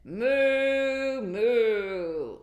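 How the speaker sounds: loud, high mooing cow; the cow answers with a loud moo. Acted. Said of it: "The cow said".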